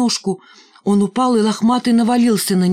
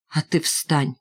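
disgusted; 2 semitones lower, 3 dB quieter, slower than usual. A narrator's reading. Миронова tells Валька to get up, disgusted. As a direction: order him up with quiet distaste. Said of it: "брезгливо сказала Миронова".

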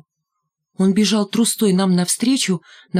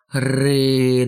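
low, drawn-out mock growl; silly growl; 6 semitones lower than usual. A narrator's reading. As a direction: growl low in an ugly put-on voice. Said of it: "дурным голосом прорычал рыжий".

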